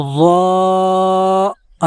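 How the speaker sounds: "ضا" with the front of the tongue pressed up. Shown as a demonstration. Teacher showing the tongue position: "ضا". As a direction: lift the tongue tip for "ضا". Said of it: "زبان کے اوپر اگلے حصے کو اوپر کی جانب".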